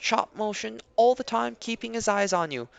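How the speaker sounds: ordinary talk, nothing marked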